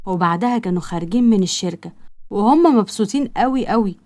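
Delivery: ordinary delivery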